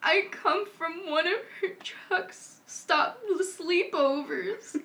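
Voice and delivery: mock crying. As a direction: wail it slowly as a pretend sob. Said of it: "Okay, so crying".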